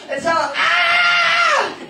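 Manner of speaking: high drawn-out scream of joy. Real, not acted. Preacher boasting until he screams, a long high cry. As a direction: let it rip as a slow scream. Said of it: "Until I scream".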